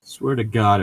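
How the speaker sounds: usual speaking voice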